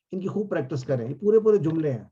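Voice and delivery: normal talking voice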